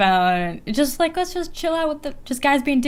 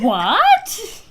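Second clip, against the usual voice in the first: mock surprised what; 10 semitones higher than usual.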